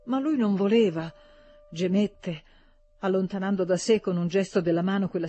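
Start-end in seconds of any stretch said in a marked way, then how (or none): none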